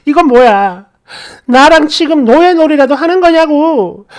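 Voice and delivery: wife shouting at husband on the phone, fed up; retold yelling. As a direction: yell it like you've had enough. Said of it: "고래고래 소리를 질러버렸어요".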